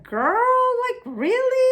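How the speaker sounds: put-on high-pitched, incredulous girlfriend voice; exaggerated high sisterly disbelief. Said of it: "facial expressions and doing like".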